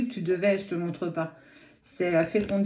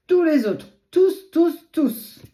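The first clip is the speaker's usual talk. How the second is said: emphatic insistence; 8 semitones higher, 6 dB louder, slower than usual. Real, not acted. Insists all other pages are fakes.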